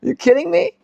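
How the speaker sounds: high-pitched mocking incredulity; sarcastic. Teacher voicing the prophet scoffing at Israel, sarcastic. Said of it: "Hosea here isn't serious".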